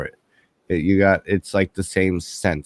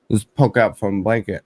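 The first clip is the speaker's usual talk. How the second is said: ordinary talk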